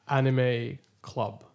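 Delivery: normal talking voice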